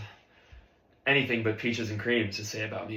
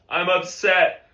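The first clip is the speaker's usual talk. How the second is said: slow, raised, loud declaration; student obeying under pressure; louder forced admission; 6 semitones higher, 6 dB louder, much slower than usual. Acted. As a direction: say it up for the whole band.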